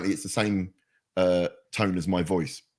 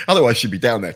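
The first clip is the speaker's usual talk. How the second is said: usual speaking voice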